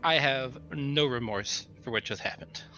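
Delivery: normal talking voice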